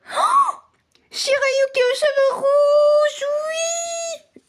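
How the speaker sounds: high, slow squeal of delight; excited squeal. Real, not acted. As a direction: gush over a favourite anime. Voit son animé préféré. (cri aigu de joie devant son animé préféré)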